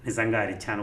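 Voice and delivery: usual speaking voice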